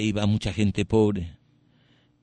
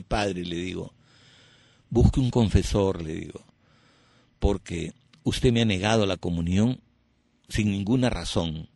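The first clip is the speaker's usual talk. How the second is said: calm reproach to the priest; calm firm reply; 4 semitones lower than usual. Shown as a demonstration. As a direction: answer him gently but firmly. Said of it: "voy a ponerle el mismo timbre de voz".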